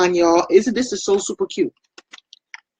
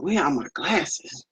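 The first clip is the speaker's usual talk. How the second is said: retold mutter; 8 dB quieter, slower than usual. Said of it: "I was up there going".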